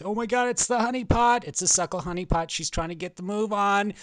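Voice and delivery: high, loud excited outburst; mock alarm. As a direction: cry out as if you just spotted trouble.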